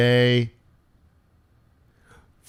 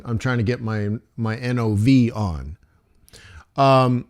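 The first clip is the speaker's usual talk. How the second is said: ordinary delivery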